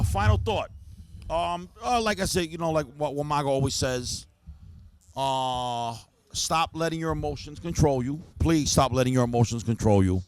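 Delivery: ordinary talk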